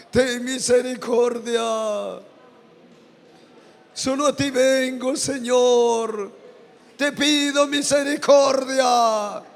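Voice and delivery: slow, pleading prayer for mercy; fervent modeled prayer. Shown as a demonstration. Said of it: "Dile a Él". (oración suplicante y lenta)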